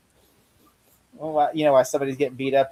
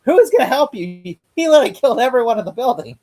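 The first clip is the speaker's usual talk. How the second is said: louder, mocking disbelief; incredulous; 8 semitones higher, 6 dB louder than usual. Real, not acted. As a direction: throw it back in disbelief. Speaker's own incredulous retort.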